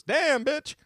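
high-pitched comic exclamation; hammed-up high admiring outburst; mock amazed catcall. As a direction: shoot the pitch way up for the punchline. Joke first words for Tin Man.

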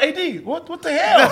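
high-pitched, incredulous retelling; imitated shocked reaction. Guest retelling a friend's reaction to the stream, mock shock. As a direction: quote him in high disbelief, breaking toward a laugh.

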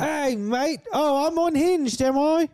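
very high, slow Australian drawl, hammed up; mock Aussie accent. Friend mocking streamer in high, slow Australian accent. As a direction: stretch unhinged like a crazed Aussie mate. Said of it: "he's trapped in the accent".

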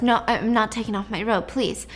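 ordinary delivery

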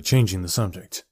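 usual speaking voice

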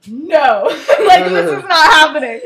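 hammed-up disbelief through laughter; mock outrage. [name] hears the rejection, mock-horrified outburst.

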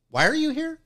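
a touch of bewildered desk cop; puzzled officer. Retold or imitated. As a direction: play the baffled officer, rising up high. Officer voiced, puzzled question.

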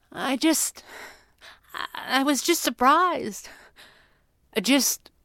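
high, halting, stammered explanation; trailing off. A narrator's reading. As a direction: grope for words, then leave it hanging. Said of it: "he trailed off".

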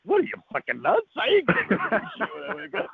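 high-pitched mock outrage; retold as a laughing-off, high-voiced refusal; incredulous. Dreamer refusing to tell everybody, incredulous.

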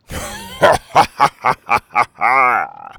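loud pirate captain cackle; long gloating laugh falling at the end; villain's laugh. A narrator's reading. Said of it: "The captain laughed".

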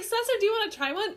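high, quick imitated offer; mock offering voice. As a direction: mimic her cheerful offer. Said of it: "she's like".

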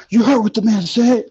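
high, loud mock tough guy; harder stern voice. Imagined left angel pressing him harder, mock tough. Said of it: "he's gonna come harder".

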